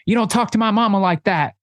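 high-pitched mock retort; hammed-up comeback, pitched well up; imitated indignant daughter. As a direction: play the movie daughter defending her mama. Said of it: "and she's like".

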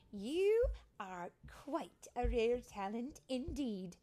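grand storybook character voice; impressed elder fairy. A narrator's reading. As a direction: savour each word of the compliment. Fairy Mary praises the tinker.

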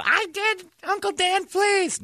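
put-on very high, pleading child's voice, rising to a shout; whiny kid begging. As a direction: beg like a desperate little boy. Cousin begging uncle to stop.

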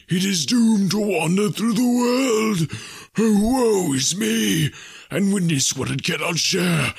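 slow, high wailing phantom voice; mournful ghost. A narrator's reading. Ghost telling Scrooge of its doom, mournful wail. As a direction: swell into a haunted lament.

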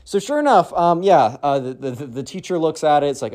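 usual speaking voice

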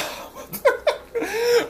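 squealing burst of laughter; high laugh. Real, not acted. Laughs after the comment.

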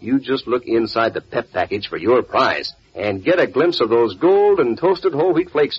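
ordinary delivery

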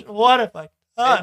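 loud, slow theatrical wail; hammed-up despair; tragic musical lament. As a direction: belt it like a Shakespearean tragedy. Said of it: "a really tragic musical".

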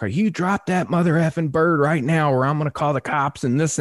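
high, insistent scolding; imitated irate threat. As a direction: mimic an angry neighbour laying down an ultimatum. Irate neighbour repeats her threat.

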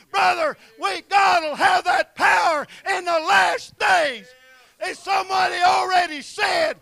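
loud, high, shouted preaching; full-force preaching. Acted. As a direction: preach it out with fire to the congregation.

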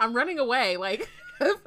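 laughing outburst. Real, not acted. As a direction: joke loudly through a laugh. Co-host joking while laughing.

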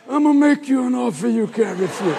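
lightly voiced mobster, half-joking threat; a hint of a godfather making a threat. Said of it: "I could hear this".

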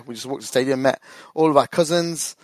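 usual speaking voice

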